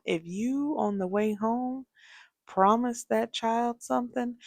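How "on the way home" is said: usual speaking voice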